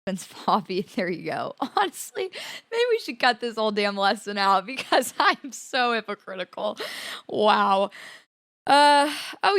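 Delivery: real laughter breaking up the words; laughing at herself. Real, not acted. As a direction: own up to hypocrisy, cracking up. Laughs at her own hypocrisy.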